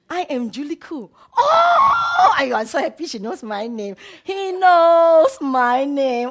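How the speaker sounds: high, loud, overjoyed cry; joyful shout. Real, not acted. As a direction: burst out with happy disbelief. Overjoyed shout being recognized.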